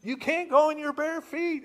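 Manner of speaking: high, loud scolding rule; fussing mom insisting on sandals; strict mother. Acted. Mary scolding young Jesus about his bare feet, high and loud. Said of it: "that's how Mary was with Jesus".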